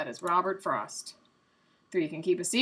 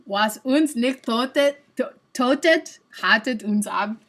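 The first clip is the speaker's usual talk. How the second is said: loud, deliberate attempt at German; butchered German; 4 semitones higher, 7 dB louder, slower than usual. Shown as a demonstration. Streamer retrying after being laughed at, in butchered German. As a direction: punch out each German word slowly. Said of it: "butcher the German for you".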